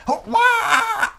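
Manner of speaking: high loud laughing shout; laughing yelp. Acted. Falling off while practicing.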